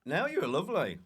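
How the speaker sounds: normal talking voice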